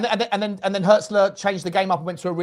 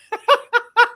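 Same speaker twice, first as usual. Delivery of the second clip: high, loud peal of laughter; real giggling fit; helpless laughter; 7 dB louder than usual. Real, not acted. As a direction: burst out laughing mid-story. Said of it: "I'm laughing because".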